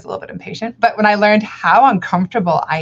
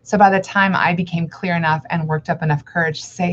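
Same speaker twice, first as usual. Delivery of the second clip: ordinary talk, nothing marked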